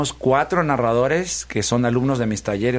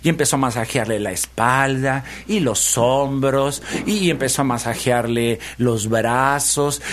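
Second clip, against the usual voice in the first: ordinary delivery, nothing marked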